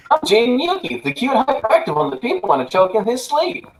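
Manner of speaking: put-on high, hyperactive kid voice; squeaky, overexcited teen boy; Jaden voice. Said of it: "You gotta be talking about Jaden".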